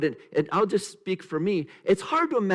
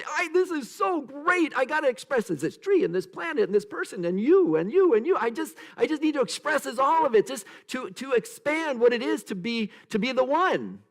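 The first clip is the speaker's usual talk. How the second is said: excited divine voice; 10 semitones higher, 3 dB louder than usual. Retold or imitated.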